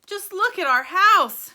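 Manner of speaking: high, loud, slow lament; dismayed cry. A narrator's reading. Mr Bucket lamenting his wrecked house, slow high cry. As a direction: wail over the ruined house. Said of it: "cried poor Mister Bucket".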